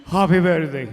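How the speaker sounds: low, lightly voiced old Cuban man; a hint of an eighty-seven-year-old Cuban friend; Mister Vieira's greeting. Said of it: "he says this".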